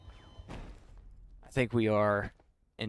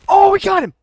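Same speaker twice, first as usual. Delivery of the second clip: high, loud victory yell; excited shout; 19 dB louder than usual. Real, not acted. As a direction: whoop over the kill. Kill secured in game.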